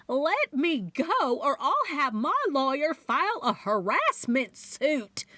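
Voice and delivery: a Southern woman scolding the cop; stern Southern voice. Acted. Loretta tells the officer off in a stern Southern accent. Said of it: "in a stern Southern voice".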